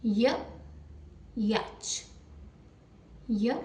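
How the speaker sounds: य said short with no vowel after; the phonic Y, य; y sound. Shown as a demonstration. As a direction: tap out य, separated by pauses. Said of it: "Y की phonic sound होती है य".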